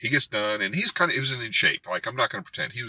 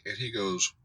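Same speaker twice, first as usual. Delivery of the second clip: ordinary talk, nothing marked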